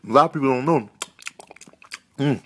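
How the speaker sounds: usual speaking voice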